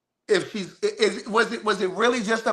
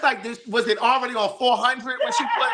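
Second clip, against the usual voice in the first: laughing; 6 semitones higher than usual. Real, not acted. Asking while laughing.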